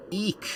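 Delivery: high scared eek; a little frightened squeak. Acted. Scared squeak while falling.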